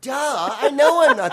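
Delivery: high, loud, slow laughing duh; mocking duh. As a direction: toss it off as obvious through a laugh. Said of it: "They're like".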